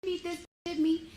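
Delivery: a hint of a frantic receptionist; mocking receptionist. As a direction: mimic her shrieking accusation. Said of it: "she just started wilding out".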